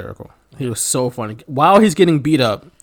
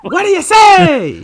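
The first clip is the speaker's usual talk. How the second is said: high, slow, hammy referee shout; Roddy Piper impression; 12 semitones higher, 5 dB louder, slower than usual. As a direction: draw it out like a ringside ref.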